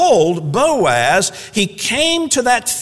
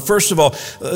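ordinary delivery

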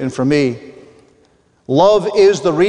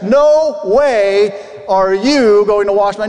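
emphatic refusal; 3 semitones higher, slower than usual. Retold or imitated. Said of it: "That's how he's actually expressing it".

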